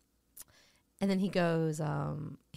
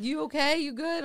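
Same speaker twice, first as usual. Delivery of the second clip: high-pitched, quick checking-in; mock concern; 7 semitones higher than usual. Calling to the comic crawling out of the club, mock concern.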